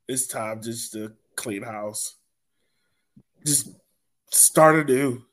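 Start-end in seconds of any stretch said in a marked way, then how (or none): none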